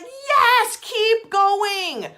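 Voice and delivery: high loud urging cheer; cheering universe. Pretend. Universe cheering you forward, high loud cheer. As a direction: cheer the runner on. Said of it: "the universe is like".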